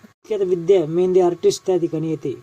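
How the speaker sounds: usual speaking voice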